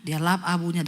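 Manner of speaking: ordinary delivery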